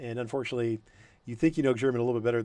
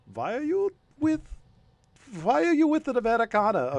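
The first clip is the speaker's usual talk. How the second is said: puzzled German guard; 13 semitones higher, 3 dB louder than usual. Acted. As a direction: squeak out a confused guard's question. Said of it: "he's like".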